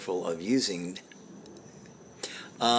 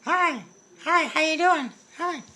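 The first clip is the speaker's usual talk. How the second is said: very high squeaky hello; silly high greeting; 4 dB louder than usual. Acted. Greeting a visitor, a very high silly voice. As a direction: greet them in a tiny squeaky voice.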